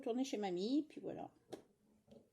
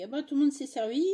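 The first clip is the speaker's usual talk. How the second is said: mock indignant husband; 5 semitones higher, 11 dB louder than usual. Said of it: "mon mari, il dit".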